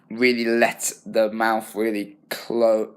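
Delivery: normal talking voice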